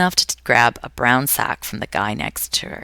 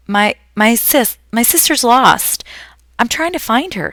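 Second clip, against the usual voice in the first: put-on frightened girl stammering, higher and louder; stuttering; 9 semitones higher, 5 dB louder than usual. A narrator's reading. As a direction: stumble over the words, scared and shaken. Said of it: "stuttered Raven".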